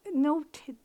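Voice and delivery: ordinary delivery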